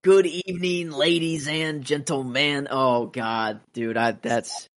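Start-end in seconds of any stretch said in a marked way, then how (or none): 0.0-2.7 high, drawn-out showman greeting; Joker. Acted. Fan quoting the villain's party entrance, Joker impression. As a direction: put on a slow, raised villain voice. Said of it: "Joker showing".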